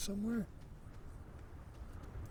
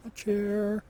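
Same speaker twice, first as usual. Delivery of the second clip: excited; 6 semitones higher, 7 dB louder than usual. Real, not acted. Repeats item name louder.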